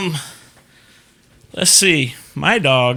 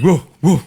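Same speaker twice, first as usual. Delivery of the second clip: two short, louder woofs; imitated dog woof; 2 semitones higher, 6 dB louder than usual. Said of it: "She's like".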